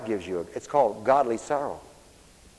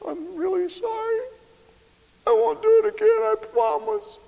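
put-on much higher, small pleading little-kid voice; tearful child. Child apologizing to a parent.

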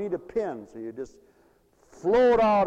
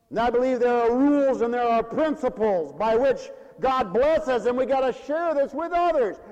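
raised preacher's cadence; preaching at full force; 9 semitones higher, 7 dB louder than usual. Acted. Preacher to congregation, ringing pulpit voice. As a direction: proclaim it from the pulpit.

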